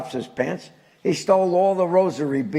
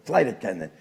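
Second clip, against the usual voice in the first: usual speaking voice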